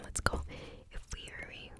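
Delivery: ordinary delivery, nothing marked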